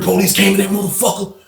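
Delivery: ordinary delivery, nothing marked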